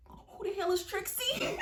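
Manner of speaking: high, drawn-out mock disbelief; comic high-pitched incredulity; incredulous squeal. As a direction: squeak it out in total bafflement. Mocking reaction to obvious lie.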